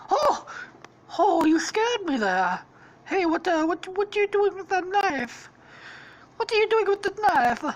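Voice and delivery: high, alarmed cartoon Italian voice; startled mock Italian. Stampy scared by Squishy's knife, high mock Italian accent. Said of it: "gonna be fucking Italian in this story".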